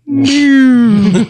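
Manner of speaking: high, loud cartoon mew; mock kitten mew. Mocking the preacher with a loud mew. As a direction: squeak out a tiny mew at the speaker. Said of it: "I was like".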